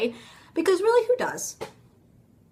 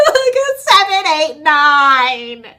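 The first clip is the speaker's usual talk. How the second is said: hammed-up laugh-cry; mock laughing through tears; 3 semitones higher, 9 dB louder, much slower than usual. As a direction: crack up at your own bad joke while crying. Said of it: "I start laughing, and I'm like".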